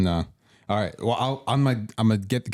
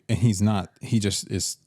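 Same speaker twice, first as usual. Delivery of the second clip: ordinary delivery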